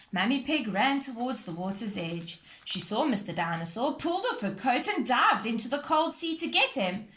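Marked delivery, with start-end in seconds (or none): none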